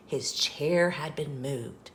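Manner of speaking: ordinary delivery, nothing marked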